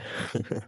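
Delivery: short, soft, breathy chuckle; mocking chuckle. Captor doubts his captive's judgement of him, mocking chuckle. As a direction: let out a quiet, knowing laugh.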